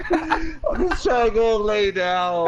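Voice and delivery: high, slow, laughing mock wail; exasperated whining. Son wants sleep while guests arrive, mock whining. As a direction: drag it out as a put-upon whine. Son complains to mom, retold.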